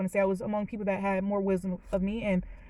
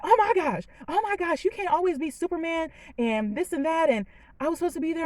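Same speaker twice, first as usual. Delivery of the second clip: higher-pitched, imitating a worked-up mother; mock frazzled outburst; frazzled mom; 8 semitones higher than usual. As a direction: retell mom's frazzled protest, pitched up. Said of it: "she's frazzled".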